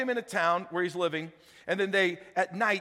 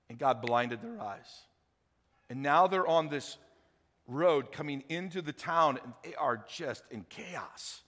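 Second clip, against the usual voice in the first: usual speaking voice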